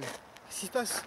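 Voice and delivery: slow, soft, dazed and lost. Acted. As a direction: drag it out like you just woke from a trance. Waking dazed from possession.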